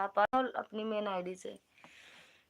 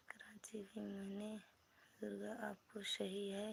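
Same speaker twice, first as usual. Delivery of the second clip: soft breathy whisper, much softer; 20 dB quieter than usual. Real, not acted. Streamer whispering about visiting Karachi, soft secret whisper.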